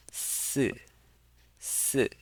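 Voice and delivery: si falling from high; fourth tone. Shown as a demonstration. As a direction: drop each "si" from the top. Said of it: "that is the fourth tone".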